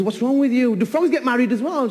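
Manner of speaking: high, loud disbelief; exasperated outburst. Acted. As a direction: throw your hands up at her. Husband exasperated at wife.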